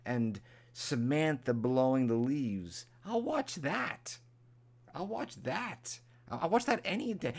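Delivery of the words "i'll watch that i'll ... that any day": eager delight. Real, not acted. Gushing about wanting cape-blowing videos, much higher and excited. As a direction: swoon over it like a treat. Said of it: "Give me an hour".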